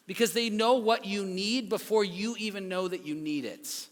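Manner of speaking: usual speaking voice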